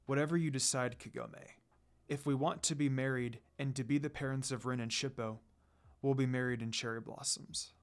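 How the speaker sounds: a reserved lord opening his heart quietly; tender confession. A narrator's reading. Sesshomaru answers Kagome.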